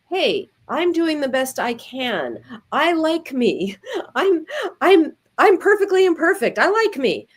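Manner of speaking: bright, upbeat self-encouragement; lightly voiced supportive inner voice; kind self-talk. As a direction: cheer yourself on kindly.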